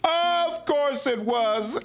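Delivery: words through laughter, high and slow; laughing. Real, not acted. Player laughing at their own rash choice. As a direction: laugh while admitting the error.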